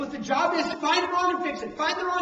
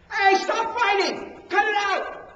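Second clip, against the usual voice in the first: shouted, higher, slower stop; parent yelling; 6 semitones higher, 6 dB louder, slower than usual. Retold or imitated. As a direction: yell at squabbling kids. Parent breaking up kids' fight.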